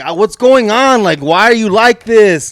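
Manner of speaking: high, loud, exasperated scolding; mock angry dad. Dad scolding his son at night, high loud exasperation. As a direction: scold like a fed-up father. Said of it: "he's mad".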